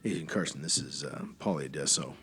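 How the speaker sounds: low, quiet official phone voice; formal agent on phone. Acted. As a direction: speak low like a by-the-book federal agent.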